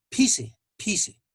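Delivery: "pise" with a strong puff of air after the p; aspirated P. Shown as a demonstration. Teacher shows learners the P with expiration, aspirated "pise". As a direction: burst a breath out on the p of "pise". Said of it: "P expiración".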